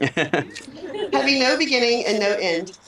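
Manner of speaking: slow, laughing restart of the line; laughing through tears. Real, not acted. Officiant resumes the ring speech laughing through tears. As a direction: push through with a teary laugh.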